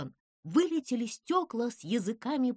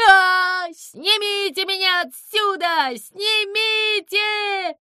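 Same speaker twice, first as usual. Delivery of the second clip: high, loud wail for help; drawn-out high shriek of alarm; panicked screaming; 10 semitones higher, 9 dB louder, slower than usual. Acted. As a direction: scream to be taken down, stretching the words. Zyza hangs upside down, screaming.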